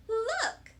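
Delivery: put-on high, eager little girl; Lucille. A narrator's reading. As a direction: call out to show something off. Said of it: "said Lucille".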